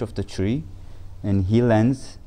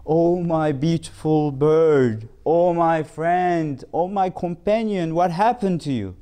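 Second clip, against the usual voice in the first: a touch of sobbing grief over the parrot; crying lament; 7 semitones higher than usual. A narrator's reading. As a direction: mourn the bird, half weeping. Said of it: "And he starts crying".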